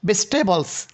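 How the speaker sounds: ভেজিটেবলস shortened to "bes-te-bols"; syncope, vowel dropped. Shown as a demonstration. Teacher demonstrates elision to students, ভেজিটেবলস as "bestebols". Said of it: "ইয়ের উচ্চারণটাকে".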